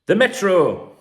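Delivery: loud, high headline call; newspaper name announced. Acted. Podcaster announcing the next newspaper, loud and high. Reading out paper names.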